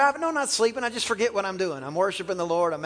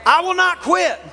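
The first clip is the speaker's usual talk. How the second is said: loud, high call for the crowd to repeat; shouted declaration; 9 semitones higher, 6 dB louder than usual. Shown as a demonstration. Preacher leading the congregation in a shouted declaration. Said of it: "Come on, just say that".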